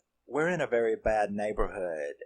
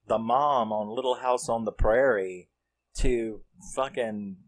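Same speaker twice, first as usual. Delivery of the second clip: freaked-out friend; 3 semitones higher than usual. Retold or imitated. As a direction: play the shocked friend who can't believe what she sees. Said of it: "so they're freaked out".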